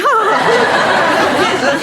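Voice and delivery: slow, high, loud mock laugh; hammed-up stage laughter, high and slow; forced fake laugh. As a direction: laugh it out like an actor covering. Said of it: "And they went".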